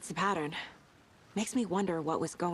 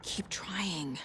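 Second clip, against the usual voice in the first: whisper; 12 dB quieter, slower than usual. Acted. Thinking aloud to herself.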